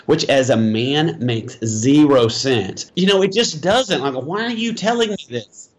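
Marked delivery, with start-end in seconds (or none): 4.1-5.7 high, puzzled why-are-you-telling-me; bewildered husband asking why; baffled question. Retold or imitated. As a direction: voice jumps up in confusion. Husband baffled by wife's story.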